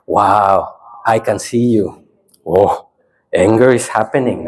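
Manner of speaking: low, unhurried noting with pauses; calm observing. Shown as a demonstration. Teacher showing the second experiment, calm observing.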